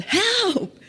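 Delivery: high desperate cry for help; yell for help. Retold or imitated. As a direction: call out for help from the water. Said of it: "So I yelled".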